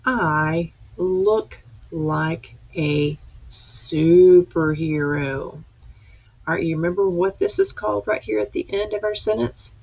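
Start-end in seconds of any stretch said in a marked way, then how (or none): 0.0-5.5 slow pointed reading. Shown as a demonstration. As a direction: stretch each word slowly for little readers. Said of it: "let's read this one one more time".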